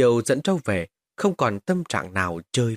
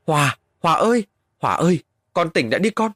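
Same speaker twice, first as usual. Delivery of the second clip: higher, louder calling out; anxious calling; 6 semitones higher, 4 dB louder than usual. Acted. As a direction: call the boy awake, worried. Parents call fainted son. (gọi con hốt hoảng, to và cao)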